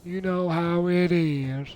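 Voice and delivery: high, slow southern drawl; put-on southern accent. Southerner on hot summers, high slow southern drawl.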